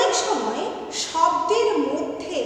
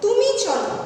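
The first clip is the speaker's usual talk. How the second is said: তুমি চলো called out higher and louder, stress on তুমি; emphatic example; 6 semitones higher, 6 dB louder than usual. Shown as a demonstration. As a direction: announce তুমি চলো with force on তুমি. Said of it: "যেমন ধরো". (জোর দিয়ে তুমি চলো)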